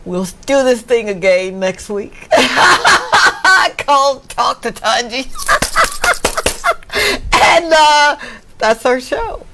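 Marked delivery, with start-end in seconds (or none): 2.3-3.7 high-pitched burst of laughter. Real, not acted. Host laughing about returning next week, high laugh. 5.3-7.4 very high peal of laughter; squealing laugh. Real, not acted. Host laughing at her show's name, squealing laugh.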